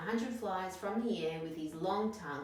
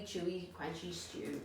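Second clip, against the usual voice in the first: usual speaking voice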